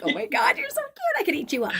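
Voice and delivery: put-on very high cooing baby talk; gushing coo. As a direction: coo over an adorable child. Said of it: "And I'm just like".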